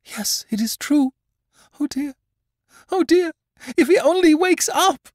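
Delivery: mother terrified for her sleeping son; panicked mother. Acted. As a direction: wail it out in growing dread.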